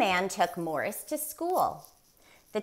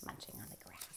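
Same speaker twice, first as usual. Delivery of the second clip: lower, whispered aside; a hushed storyteller's aside; 13 semitones lower, 24 dB quieter, much faster than usual. A narrator's reading. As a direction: breathe it out as a secret. Narrator drops to a whisper.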